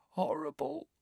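soft, very high croak. A narrator's reading. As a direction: croak it weakly. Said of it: "croaked Dudley".

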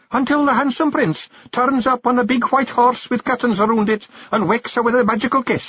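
very high fairy character voice; high-pitched, earnest little fairy; eager fairy storyteller. A narrator's reading. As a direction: spin the fairy tale in a high voice. Billy embroidering the princess tale.

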